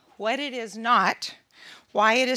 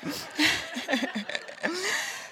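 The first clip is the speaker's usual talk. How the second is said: real laughter; 6 semitones higher, 6 dB quieter than usual. Real, not acted. Laughing after punchline.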